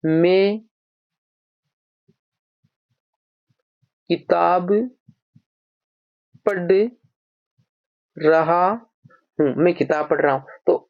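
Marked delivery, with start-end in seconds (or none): 0.0-9.5 long pauses, last words dragged very slowly. Shown as a demonstration. Teacher laying out an example sentence for the class, slowly. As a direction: stretch the sentence out word by word. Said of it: "पढ़ना".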